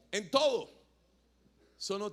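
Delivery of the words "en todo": emphatic. Retold or imitated. Pastor admonishing Alejo firmly.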